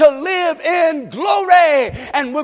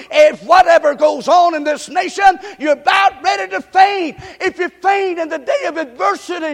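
louder, higher, shouting the warning; preaching at full force; 5 semitones higher, 3 dB louder than usual. Acted. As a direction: press it on the congregation. Preaching climb.

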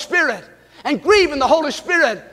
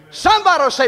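normal talking voice

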